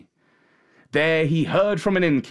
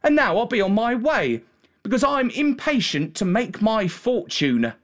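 put-on higher-pitched young country lad; boy's voice, pitched up; shepherd boy; 8 semitones higher than usual. A narrator's reading. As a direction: give the lad a lighter, higher voice. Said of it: "said the boy".

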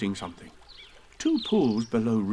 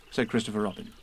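plain reading voice

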